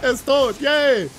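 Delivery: ordinary delivery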